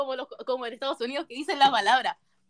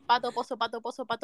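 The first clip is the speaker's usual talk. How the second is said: repetición rápida; 5 semitones lower, 5 dB quieter than usual. Real, not acted. Repite el trabalenguas rápido.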